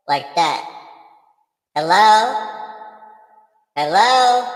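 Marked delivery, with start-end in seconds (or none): 1.7-4.5 high echoing ghostly call; echo voice effect. Shown as a demonstration. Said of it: "you gotta have the echo in there".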